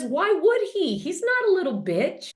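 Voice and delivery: high-pitched imitation of an indignant wife; scornful retort. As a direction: throw it back in a high voice. Wife's retort in retold quarrel.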